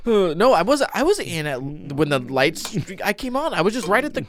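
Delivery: high-pitched protesting excuses; mock panicked denial. Joking employee making excuses to mom, mock panicked. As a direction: squirm through a playful alibi, voice up high.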